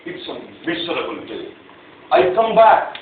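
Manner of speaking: ordinary talk, nothing marked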